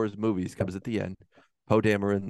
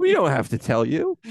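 higher, louder sarcastic refusal; mock defiance; 4 semitones higher, 5 dB louder than usual. Filmmakers refuse to explain to audience, mock indignant. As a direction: refuse indignantly, pitch climbing at the end.

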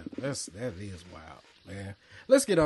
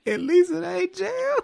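much higher, slow, cracking with laughter; laughing falsetto quote; slower than usual. Real, not acted. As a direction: squeal the motto through laughs. Quoting motto, laughing.

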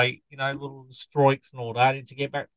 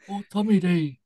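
ordinary delivery

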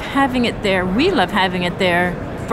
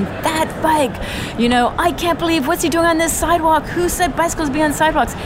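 high-pitched imitation of a ranting man; pitched-up mock yell of disbelief; mock screaming; 7 semitones higher than usual. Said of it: "and he's screaming".

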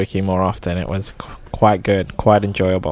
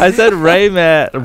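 insisting; 8 semitones higher, 4 dB louder, slower than usual. Real, not acted.

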